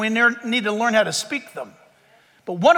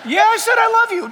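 high, loud, impatient 'Yeah, I said I love you'; snapping back at being asked again; exasperated reply; 11 semitones higher, 5 dB louder than usual. Acted. Spouse asked again about love, answers in an exasperated snap. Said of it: "Don't say it like that".

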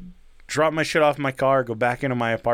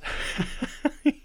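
breathy giggle imitation; 4 dB quieter than usual. Neighbor's odd laugh imitated.

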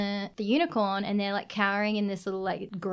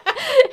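laugh; 9 dB louder than usual. Real, not acted. Laughing at pig goblin memory.

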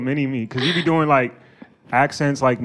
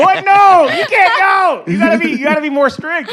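exclaimed protest; 5 dB louder than usual. Real, not acted. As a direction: cut in loudly to stop the scene. Interrupts role-play to direct her.

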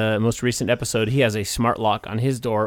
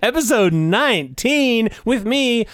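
announcer voice; 9 semitones higher, slower than usual. Acted. Announcing the show intro.

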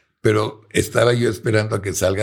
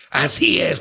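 retold shout; 7 semitones higher than usual. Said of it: "gritándome".

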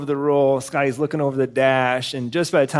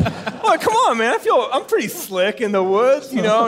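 laughing self-quote; 9 semitones higher than usual. Retold or imitated. Imitating himself, laughing.

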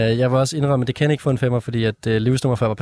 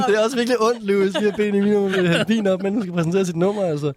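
laughing; 8 semitones higher than usual. Real, not acted. Teasing co-host, laughing.

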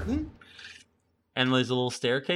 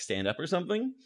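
normal talking voice

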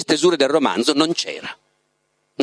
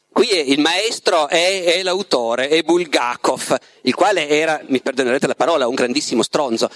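plain reading voice

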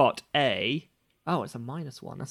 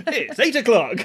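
higher, laughing clock announcement; talking alarm clock; 10 semitones higher, 7 dB louder than usual. Acted. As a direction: chime the time, laughing. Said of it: "It's a talking clock".

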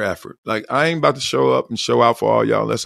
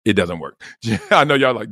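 usual speaking voice

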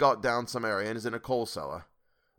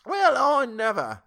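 put-on high, slow old lady exclaiming; granny falsetto; 13 semitones higher, 7 dB louder, slower than usual. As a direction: shriek it like a startled granny. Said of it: "said the old lady".

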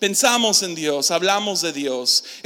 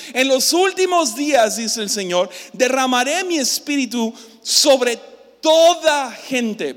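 higher, louder proclaiming read; scripture reading; 5 semitones higher, 5 dB louder than usual. Acted. Preacher proclaiming the prophecy aloud, higher and louder. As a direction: proclaim the verse to the congregation.

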